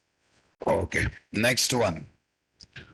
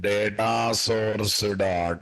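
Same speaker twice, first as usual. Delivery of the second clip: dictating; 3 semitones lower, much slower than usual. Shown as a demonstration. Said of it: "teacher dictates code slowly".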